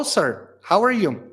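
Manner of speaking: ordinary talk, nothing marked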